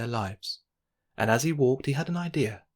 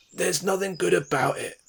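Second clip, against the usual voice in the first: higher, louder grouchy comeback; grumpy retort; 7 semitones higher, 4 dB louder than usual. A narrator's reading. Mister Grumpy answering Mister Calm's greeting, grouchy retort. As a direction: snap it back like a grump. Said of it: "retorted Mister Grumpy".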